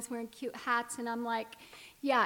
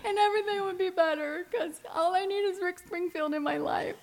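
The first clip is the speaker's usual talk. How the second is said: put-on high, loud lovesick teen plea, close to tears, then a laugh; teenage me, gushing; 8 semitones higher, 5 dB louder than usual. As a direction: plead like a starry-eyed thirteen-year-old fan. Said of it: "and I'm like".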